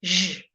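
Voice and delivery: Zh buzzed with voice like a voiced sh; teacher models the zh sound. Shown as a demonstration. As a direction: hum through the Zh. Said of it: "put a voice into it".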